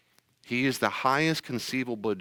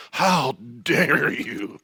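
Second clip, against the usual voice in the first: slow, drawn-out indignant how dare you; mock outrage; 5 dB louder, much slower than usual. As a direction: play it as hammed-up indignation. Outraged reply to a police officer.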